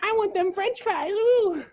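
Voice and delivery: whiny demand in mock falsetto; very high, squeaky imitation, insisting; Sophie. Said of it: "She's like".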